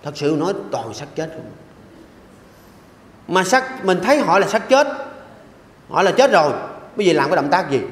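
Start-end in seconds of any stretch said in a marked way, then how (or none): 0.0-1.5 hushed, much softer aside; soft confiding murmur; said softly. Shown as a demonstration. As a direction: drop the voice as if not to be overheard. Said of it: "Nói nhỏ nhỏ".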